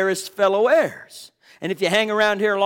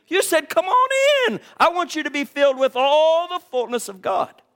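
higher, louder, rising preacher's cry; fervent preaching; 9 semitones higher, 4 dB louder than usual. Acted. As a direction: preach it out with mounting fervor. Preacher builds to full force.

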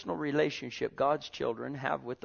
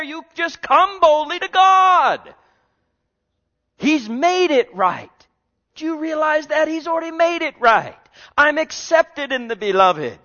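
full-force preaching; 12 semitones higher, 11 dB louder than usual. Real, not acted. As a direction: proclaim it at the top of your fervor.

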